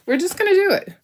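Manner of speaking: cheery high-pitched we're just gonna do it; bold resolve. Real, not acted. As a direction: pitch leaps up on the repeat, plucky. Decisive repeat, much higher.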